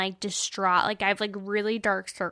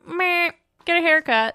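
breezy meh; 7 semitones higher, 6 dB louder than usual. Retold or imitated. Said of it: "Now I'm like".

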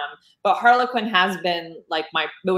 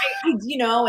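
laughing through a friend's quote, higher; half-laughing take on an Australian friend's words; laughing imitation; 6 semitones higher, 3 dB louder than usual. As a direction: giggle out the stammer. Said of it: "she's like".

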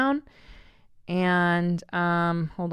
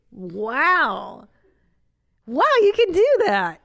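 mock amazement, high and loud; sarcastic wow; 7 semitones higher, 5 dB louder, slower than usual. To viewers about salsa bowls, sarcastic high amazement. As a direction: gush sarcastically over an obvious tip.

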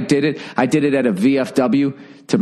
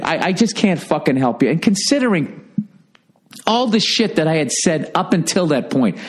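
ordinary delivery

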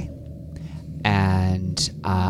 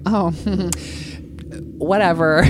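dismissive; 10 semitones higher than usual. Pretend. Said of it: "be like".

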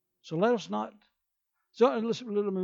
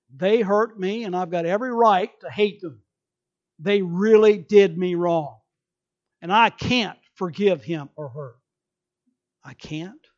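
loud, resentful excuse; loud voiced grudge; bitter complaint; 9 dB louder than usual. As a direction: play the wronged, bitter person. Voicing a bitter person's excuse.

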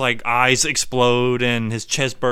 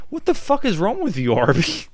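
mock outraged Wendy; 6 semitones higher than usual. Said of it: "And Wendy's like".

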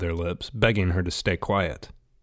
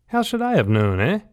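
put-on defensive boy shooting back a retort; spat back; 8 semitones higher than usual. A narrator's reading. Rat snaps back at John over the saffron mix-up, mildly annoyed. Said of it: "Rat spat back".

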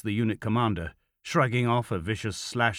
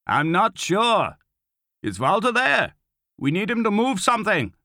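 high, loud reply from a distance; calling back; 11 semitones higher, 5 dB louder than usual. A narrator's reading. Lieutenant answering commander from the cellar, calling out. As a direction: holler it up from another room.

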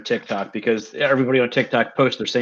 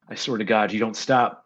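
lower, softer exasperated mutter; a weary dad giving up; 5 semitones lower, 5 dB quieter than usual. Retold or imitated. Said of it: "he's just like".

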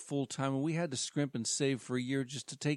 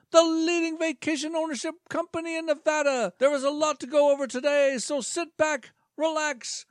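high, upbeat pitchman voice; salesman voice; 14 semitones higher, 7 dB louder than usual. Acted. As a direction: pitch it like a cheesy presenter. New presenter character.

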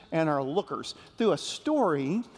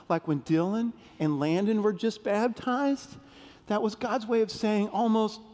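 ordinary delivery

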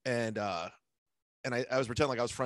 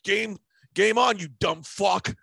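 higher, louder taunting threat; mock challenge; 6 semitones higher, 4 dB louder than usual. Comic retells a rival's angry message, raised and taunting. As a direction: throw down the gauntlet, slowing on game on.

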